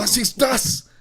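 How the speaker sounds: high, loud German cry; shocked German outburst; German exclamation. Retold or imitated. Imitating German streamer.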